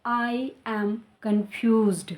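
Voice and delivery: very slow, clear ed on "confused"; slow correct take. Shown as a demonstration. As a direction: give the right model slowly. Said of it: "तो हम क्या बोलेंगे".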